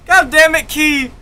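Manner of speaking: God damn it, Key shouted with a jump up in pitch; excited shout. Real, not acted. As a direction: yell it out in disbelief. Shouted reaction after a mutter.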